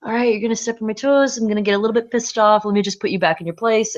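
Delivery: riled-up New Yorker putting someone in his place; a bit New York. Retold or imitated. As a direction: give it some heated New York push. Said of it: "I did go a little bit New York".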